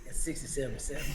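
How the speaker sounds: hushed, low and very soft; whispering. Real, not acted. Repeating the embarrassing score when co-host asks, whispering. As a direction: drop right down to a near-whisper. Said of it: "Do you whisper it?".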